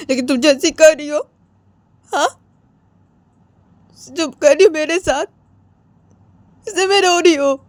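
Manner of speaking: high, plaintive scolding; wounded lover's reproach; hurt accusation. Acted. Accusing the other of causing tears.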